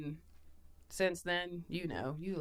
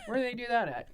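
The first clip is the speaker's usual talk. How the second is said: higher-pitched, bewildered questioning; baffled disbelief; 7 semitones higher, much faster than usual. Retold or imitated. Guest retelling her reaction to only-child families, baffled. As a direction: act out puzzled disbelief, amused. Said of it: "That was weird for me".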